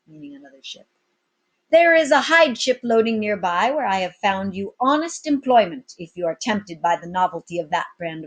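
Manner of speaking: loud, high swaggering captain; commanding captain. Acted. Captain addressing his pirates, loud and bold. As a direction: address the crew like an old sea dog.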